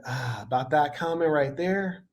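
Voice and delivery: ordinary talk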